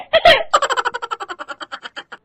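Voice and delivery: high, long fake sobbing that fades; theatrical wail. Mother offended by husband's remark, theatrical wailing. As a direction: wail out a trailing mock sob. Mother wails after her tirade.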